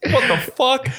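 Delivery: high-pitched, hammed-up disbelief; mock yelling. Viewer learning favourite actor is British, mock yelling. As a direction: yelp at the TV in mock shock. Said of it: "Things you yell at the T V".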